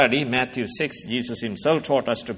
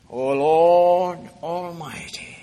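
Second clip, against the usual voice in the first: solemn prayer; 2 semitones higher, slower than usual. Acted. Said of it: "Verse sixteen".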